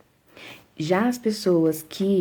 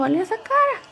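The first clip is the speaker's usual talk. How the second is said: much higher, teasing disbelief; mock dismay. Real, not acted. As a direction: point at the face and mock it. Mocking her own face. (espanto brincalhão, bem agudo)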